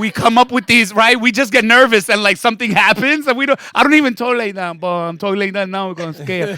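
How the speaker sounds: excited riff. Real, not acted. Comedian explaining Latinos with cops, loud excited. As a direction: pump it up, fired up.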